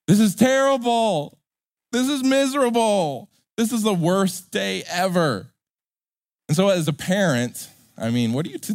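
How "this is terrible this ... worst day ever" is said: put-on higher, whiny kid's lament; mock tantrum wail; wailing little boy. Little boy in the checkout line mourning lost candy. Child's candy taken away.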